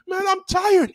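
high, whiny protest; tired pupil. Retold or imitated. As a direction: imitate a kid woken in class.

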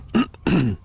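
short rough throat clear. Real, not acted. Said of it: "I'm losing my voice".

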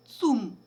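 "цум" read as a single word and pushed out loud. Shown as a demonstration. Teacher showing pupils how to read "цум", as a word and loud. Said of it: "і читаємо як звичайне слово".

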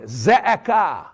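"ze'ekah" as Hebrew: ze-e-KAH, stress on the last syllable, slow and clear; Hebrew word ze'ekah. Shown as a demonstration. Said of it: "we hear this word".

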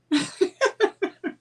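a bright, high burst of laughter. Real, not acted. After telling the coffee story, a high burst of laughter. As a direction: laugh it off at yourself.

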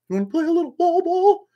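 very high squeaky inmate voice; squeaky, high-pitched voice for his friend; wall ball line. Retold or imitated. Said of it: "[name], say the wall ball line".

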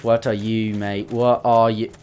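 normal talking voice

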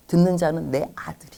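ordinary talk, nothing marked

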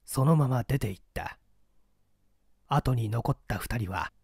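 ordinary delivery, nothing marked

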